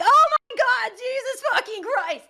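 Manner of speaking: high, loud pained yelling of profanities; retold scream. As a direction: yell it out like the wax just got ripped off. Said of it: "scream yelling profanities".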